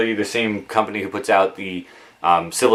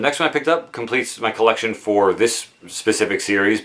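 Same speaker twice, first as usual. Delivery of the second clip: ordinary delivery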